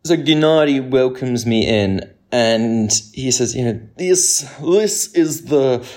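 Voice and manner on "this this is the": put-on slow, high Ukrainian-Russian accent that keeps slipping; grand, slow and a little wobbly Ukrainian accent. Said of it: "my accent is going all over the shop".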